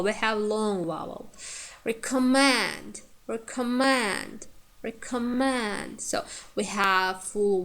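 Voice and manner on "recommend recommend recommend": "recommend" said slowly with weight on "mend"; "recommend" drawn out to show its strong last syllable; stress on third syllable. Shown as a demonstration. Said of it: "syllable is stressed".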